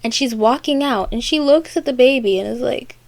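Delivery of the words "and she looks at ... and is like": ordinary delivery, nothing marked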